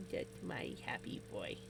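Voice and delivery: low soft praise for the dog; cooing to the dog. Real, not acted. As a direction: coo gently to a happy pet. Cooing to pet.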